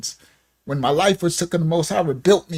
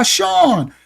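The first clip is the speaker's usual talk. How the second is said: raised, high shout of the name; calling out a name; 10 semitones higher than usual. Retold or imitated. Mondo calling after his friend, raised high shout. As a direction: hail him from across the room. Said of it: "he called me".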